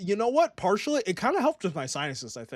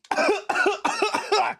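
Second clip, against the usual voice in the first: choking cough. Real, not acted. Choking on water mid-stream.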